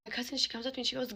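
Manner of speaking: usual speaking voice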